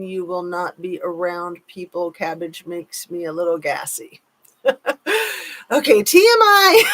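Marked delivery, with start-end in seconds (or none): 4.6-6.9 bursting out in high laughter; squealing laugh at too much info. Real, not acted. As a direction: laugh through it, high and loud. Laughing reaction to gassy chat.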